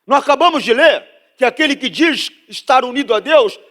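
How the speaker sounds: loud, high-pitched preacher driving the point home; full-force preaching. Real, not acted. (pregação inflamada, em voz alta)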